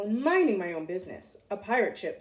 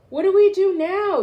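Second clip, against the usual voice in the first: loud, high panicked cry; pirate yelling; 10 semitones higher, 10 dB louder than usual. A narrator's reading. A pirate yells in the storm, loud and high. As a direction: yell it over the storm. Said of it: "yelled one of the pirates".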